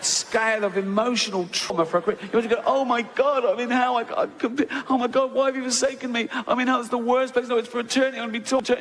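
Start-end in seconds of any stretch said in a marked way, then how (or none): 2.7-8.8 mock panic.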